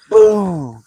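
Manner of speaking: loud, drawn-out high boom; mimicked boom. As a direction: boom it out like a door bursting open. Mimics door bursting open in retelling.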